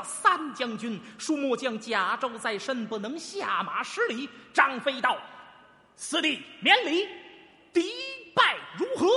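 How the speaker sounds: loud, high, rousing storyteller's recital of the Changban battle; Beijing pingshu storytelling. Shown as a demonstration. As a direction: tell it like a pingshu master with full spirit and drive. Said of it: "我给您学学". (评书腔，高亢激昂，精气神十足)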